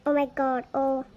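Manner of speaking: ordinary talk, nothing marked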